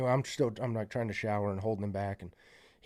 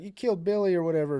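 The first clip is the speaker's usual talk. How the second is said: higher mock cry of accusation; retold scream; 6 semitones higher, 4 dB louder, faster than usual. Friend accusing the man over Billy, mock scream. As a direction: imitate a raised-voice accusation. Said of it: "they start scre".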